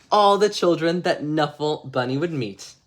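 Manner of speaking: plain reading voice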